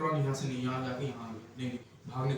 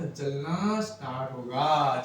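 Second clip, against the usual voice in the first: drawn-out जलना start होगा to drive the point home; slow emphatic answer; much slower than usual. Shown as a demonstration. Teacher answering his own question slowly for students. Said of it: "यहाँ पे bulb क्या हुआ?".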